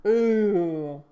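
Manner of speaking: loud drawn-out ooh of admiration; impressed ooh. Real, not acted. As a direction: savour the clever move. Impressed by the plot trick.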